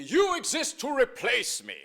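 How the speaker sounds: loud, high mock-stern father voice; half-joking, like a proud father teasing his son. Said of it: "My father used to joke".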